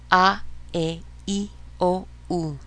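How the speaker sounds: "A, E, I, O, U" as ah, eh, ee, oh, oo; Spanish vowel sounds. Shown as a demonstration. Teacher leading a practice drill, "A, E, I, O, U" as Spanish vowels. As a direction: chant "A, E, I, O, U" in separate pure Spanish vowels. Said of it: "actual sounds of the vowels in the Spanish".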